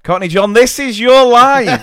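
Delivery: big booming host announcement, slowing down; mock game show host.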